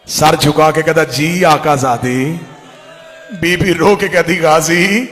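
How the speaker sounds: loud, wailing majlis narration; full-force preaching. Acted. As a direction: call the scene out to the weeping crowd. (ज़ोरदार रोती मजलिसी आवाज़)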